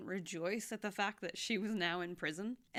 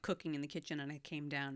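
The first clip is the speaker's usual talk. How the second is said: ordinary talk, nothing marked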